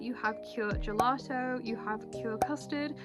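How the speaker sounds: usual speaking voice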